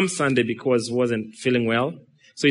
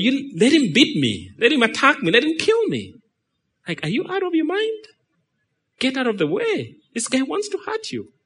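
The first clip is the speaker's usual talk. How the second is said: high-pitched, exasperated protest at a useless guard; acted-out indignation, pitch climbing high; indignant disbelief; 10 semitones higher than usual. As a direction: protest in rising, incredulous outrage. Acted scolding of a failing guard.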